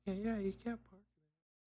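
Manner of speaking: high, slow, softened mock yell; retold echoing yell. Companion backs up the dreamer, softly imitated yell. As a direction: imitate a second person calling out, held back. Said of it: "someone else also yelled".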